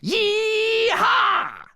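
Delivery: long, very high loud whoop; yell. A narrator's reading. Queen charges at the cell wall, yelling high. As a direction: whoop it out at full volume.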